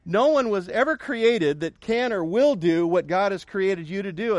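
normal talking voice

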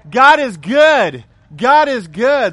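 slow, loud, ringing God is good; loud proclamation. Acted. Preacher booms God is good to the congregation. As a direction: proclaim it to the room.

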